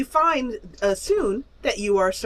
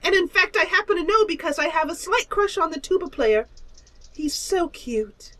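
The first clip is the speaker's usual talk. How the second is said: high giggly gossip; swooning high little monster; gushing creature voice; 11 semitones higher, 5 dB louder than usual. Acted. Creature gushes about tuba player.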